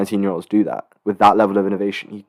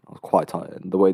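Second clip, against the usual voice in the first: normal talking voice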